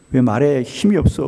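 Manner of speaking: usual speaking voice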